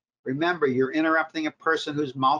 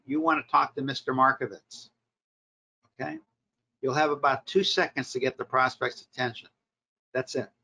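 usual speaking voice

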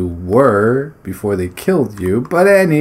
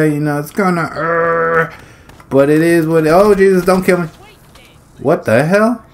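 ordinary delivery

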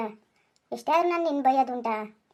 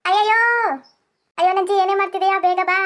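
much higher and louder, yelping for help; panicked cry; 7 semitones higher, 10 dB louder than usual. Acted. Husband kicked by the cow yelling for his wife, panicked cry. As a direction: cry out in sudden pain.

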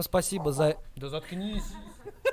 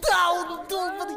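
yelling in frustration, high and loud; angry shout; 10 dB louder than usual. Real, not acted. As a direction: blow up at the screen. Angry gamer yelling at teammate.

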